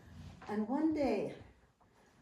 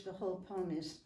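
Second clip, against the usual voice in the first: ordinary talk, nothing marked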